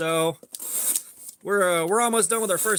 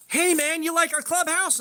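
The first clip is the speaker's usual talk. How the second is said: put-on high cocky kid voice; mocking kids; 9 semitones higher than usual. Twelve-year-olds taunting them about their clubhouse, high and cocky.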